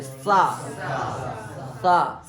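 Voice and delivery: सा with the tongue against the teeth; dental sa. Shown as a demonstration. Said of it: "तो जीभ को दांत में मारो जा करके". (दंत्य सकार)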